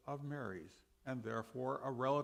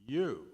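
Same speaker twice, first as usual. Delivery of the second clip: "you" pushed out a little louder; stress on you; 5 dB louder than usual. Shown as a demonstration. Preacher reading John, stressing "you" a little louder. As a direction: lean on "you" for the readers. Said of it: "Notice I've emphasized the word "you".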